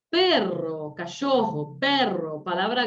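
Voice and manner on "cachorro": ordinary talk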